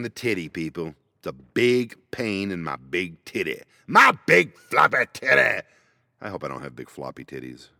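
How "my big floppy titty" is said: louder, higher mock yell; comic shout. Joking to viewers about his chest, loud mock yell. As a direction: blurt it out like a joke punchline.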